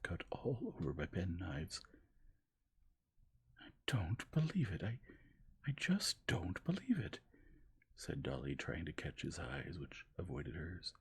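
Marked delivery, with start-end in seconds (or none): 3.6-7.2 high, loud refusal to believe; anguished disbelief. A narrator's reading. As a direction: refuse it with all her heart. Dolly in disbelief.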